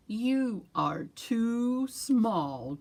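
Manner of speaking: very slow, drawn-out turtle. Acted. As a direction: stretch every word like a turtle. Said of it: "Turtle said".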